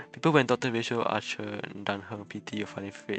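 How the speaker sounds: normal talking voice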